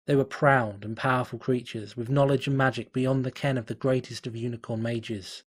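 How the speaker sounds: ordinary delivery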